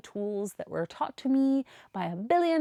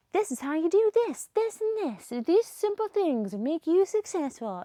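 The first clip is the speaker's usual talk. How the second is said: high, mock-preachy lecturer voice; mock guru; 6 semitones higher than usual. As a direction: parody a smug online coach, higher. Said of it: "sit in front of a camera and be like".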